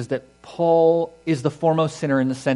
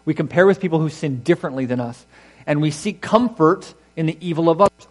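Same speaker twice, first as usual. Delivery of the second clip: usual speaking voice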